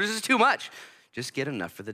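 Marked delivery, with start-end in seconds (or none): 0.0-0.7 mock overwhelmed. Overwhelmed reader, acted.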